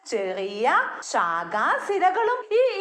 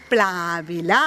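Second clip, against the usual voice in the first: teacher's model word; 10 semitones lower than usual. Shown as a demonstration. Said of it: "എല്ലാവരും ശബ്ദത്തിൽ പറഞ്ഞേ".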